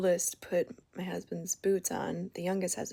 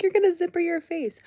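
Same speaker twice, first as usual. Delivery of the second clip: much higher, louder, laughing warning; amused warning; 11 semitones higher, 7 dB louder than usual. Retold or imitated. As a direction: squeal the warning at them. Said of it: "And I was like".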